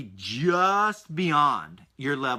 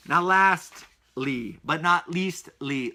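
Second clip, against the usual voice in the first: slow, playful; much slower than usual. Real, not acted. Drawn-out playful transition.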